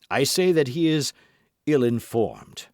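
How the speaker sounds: firm insistence. Acted. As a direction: stand your ground before the king. Treville insists firmly.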